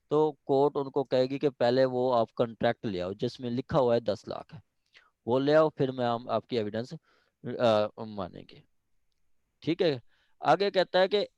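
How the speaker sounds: ordinary delivery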